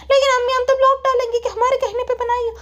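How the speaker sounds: high, insistent daughter voice; whiny daughter. Acted. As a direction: play the daughter pleading in a high pitch. Daughter figure again. (ज़िद्दी बेटी की ऊँची आवाज़)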